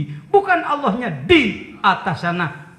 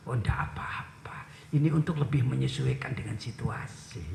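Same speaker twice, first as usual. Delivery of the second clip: soft reply; 9 semitones lower, 10 dB quieter than usual. Retold or imitated. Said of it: "Sunan Kalijaga replies".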